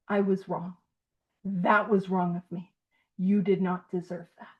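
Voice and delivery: low, quiet, measured admission; grave, softened demonstration of an apology; sincere amend. Shown as a demonstration. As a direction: own up softly, with pauses between sentences.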